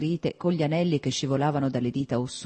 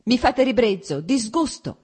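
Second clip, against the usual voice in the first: high, loud disgust flung at him; heated shout; 7 semitones higher, 5 dB louder than usual. A narrator's reading. Wife shouting her disgust at her husband, furious. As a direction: spit out the disgust, louder. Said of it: "gridò lei riscaldandosi ancora di più".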